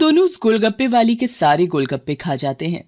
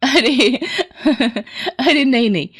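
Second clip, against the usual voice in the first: bursts into a light laugh; a short amused giggle; 6 semitones higher than usual. A narrator's reading. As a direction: laugh off the teasing. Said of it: "सरला उसकी बात सुनकर हंसने लगती है".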